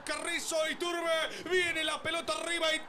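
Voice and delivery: excited goal commentary. Real, not acted. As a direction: keep riding the goal euphoria. Goal replay, still celebrating.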